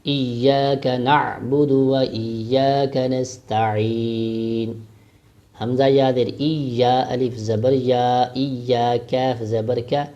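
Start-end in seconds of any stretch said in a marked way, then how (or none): 0.1-4.7 slow measured Quranic recitation; tajweed recitation. Shown as a demonstration. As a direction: chant each word slowly and clearly. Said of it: "recitation demo".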